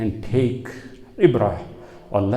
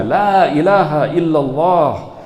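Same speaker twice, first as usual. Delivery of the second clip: higher, louder, quicker cry; retold shout; 5 semitones higher, 5 dB louder, faster than usual. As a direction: imitate a shouted declaration of faith. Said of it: "He is shouting the kalima".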